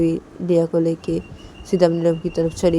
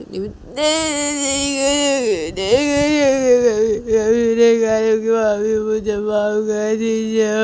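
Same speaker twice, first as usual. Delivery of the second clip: much higher, slow sobbing pleas; crying child begging; 4 semitones higher, 4 dB louder, slower than usual. Acted. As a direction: beg for mercy through tears like a little girl. (रोती बच्ची की माफ़ी की गुहार)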